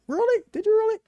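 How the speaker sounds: very high teasing disbelief; mock astonishment. Host ribbing friend about better promotion, mock amazement. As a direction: feign amazement in a squeaky-high voice.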